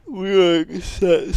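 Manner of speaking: talking through a real yawn; high, stretched words opening into a yawn. Real, not acted. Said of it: "So I am yawning".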